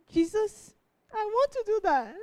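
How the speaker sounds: high, tearful prayer breaking through; crying. Real, not acted. Speaker to the youth group, choked up with tears mid-prayer. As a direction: pray to Jesus through rising tears. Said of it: "why am I crying?".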